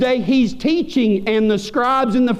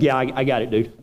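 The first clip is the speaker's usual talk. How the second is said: lazy roommate brush-off; 9 semitones lower, 3 dB quieter, faster than usual. Retold or imitated.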